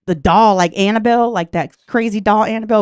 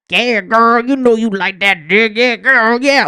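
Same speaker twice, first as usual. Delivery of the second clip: ventriloquist doll voice; 5 dB louder, slower than usual. Retold or imitated. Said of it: "I hear this extra voice in the background".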